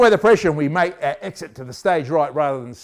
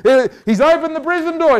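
ordinary talk, nothing marked

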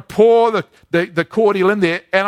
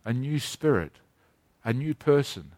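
a soft, gentle promise, lightly voiced; voicing God tenderly; 8 semitones lower, 9 dB quieter than usual. Preacher voicing God's soft promise to hardened hearts, gently. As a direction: speak as God, quietly reassuring the hurt. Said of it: "God, by his Holy Spirit, says to us".

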